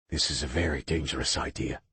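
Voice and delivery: low, thick rasp; rasped thickly. A narrator's reading. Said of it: "he rasped thickly".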